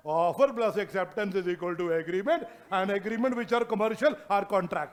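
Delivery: each word spaced for notes; slow dictation. Shown as a demonstration. Teacher dictating a two-line summary, slow. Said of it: "dictating summary to class".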